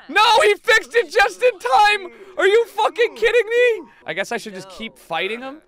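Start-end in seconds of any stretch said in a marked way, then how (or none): 0.1-3.9 high, loud, indignant shout; outraged yelling. Real, not acted. Player thwarted by bad timing in game, outraged shout. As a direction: yell in exasperated disbelief.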